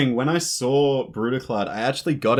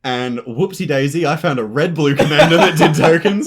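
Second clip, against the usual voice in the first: laughing; 4 semitones higher, 10 dB louder than usual. Real, not acted. Mock surprise at own deck.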